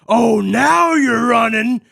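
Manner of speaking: loud, high, drawn-out taunting yell; imitated angry yell. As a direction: holler it after a fleeing kid, hammed up. Retold angry man screaming after kid.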